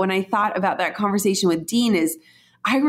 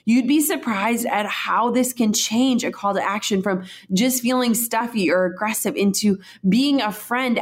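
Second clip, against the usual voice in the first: usual speaking voice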